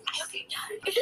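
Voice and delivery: whispered, a teasing threat; playful whisper. Pretend. Voicing the cat to her, in a mock whisper. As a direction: breathe it out like a secret curse. Voicing cat's clingy complaint.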